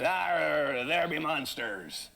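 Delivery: lightly voiced pirate growl, slow on monsters; a hint of an old sea dog telling tales. Shown as a demonstration. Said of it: "I would just".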